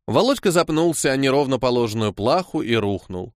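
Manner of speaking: plain reading voice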